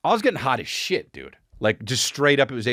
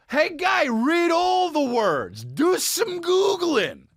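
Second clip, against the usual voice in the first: high, drawn-out condescending scold; mock scolding; much slower than usual. Mocking the unsavvy friend, high condescending scold. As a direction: talk down to him like a slow child.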